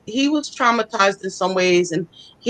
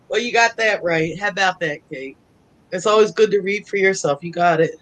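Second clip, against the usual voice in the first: ordinary talk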